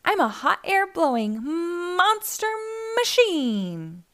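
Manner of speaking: a mildly hammed-up triumphant cry; excited cry. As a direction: pitch soaring on monster, then dropping. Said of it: "Blaze cried".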